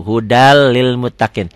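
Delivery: slow "hudal lil" with a doubled L and no N; N dropped into L. Shown as a demonstration. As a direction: lean on the joined L in "hudal lil". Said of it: "N-nya jadi hilang".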